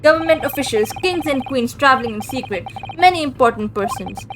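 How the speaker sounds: proud Russian guard showing off the cabins; boastful heavy Russian accent. Acted. Stroganoff brags to the detective in a Russian accent.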